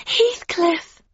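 high, loud lovestruck call; swooning heroine's outburst; ecstatic cry. A narrator's reading. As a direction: cry out your lover's name. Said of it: "cried Catherine".

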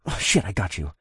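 understated grunt. A narrator's reading. Man catching the falling Valerie, lightly grunted. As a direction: say it with a slight grunt of effort. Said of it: "I grunted".

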